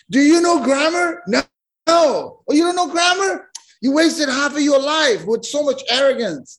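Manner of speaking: lightly voiced sneer at the ignorant sailor; mocking arrogant scholar. Arrogant grammarian mocks the sailor.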